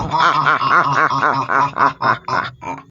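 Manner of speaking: loud crazed cackle; maniacal laugh. Acted. Monster girlfriend bursting into loud crazed laughter. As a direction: laugh like a freaking idiot. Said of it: "started laughing again like a freaking idiot".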